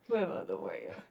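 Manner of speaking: low, soft imitation of the co-host; quiet, deep-pitched teasing repeat; mock echo. Echoes co-host's line as a joke.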